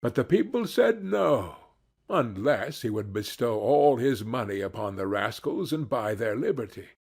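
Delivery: usual speaking voice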